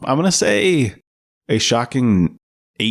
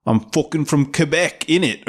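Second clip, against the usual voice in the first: higher-pitched put-on British accent; mock British accent; 5 semitones higher than usual. Imagining Don Cheadle's character with that accent, mock British. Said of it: "showed up with the same accent".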